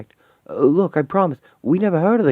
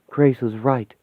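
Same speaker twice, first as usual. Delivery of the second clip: ordinary delivery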